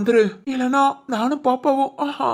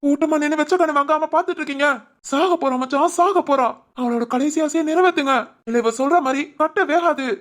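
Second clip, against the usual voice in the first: ordinary talk, nothing marked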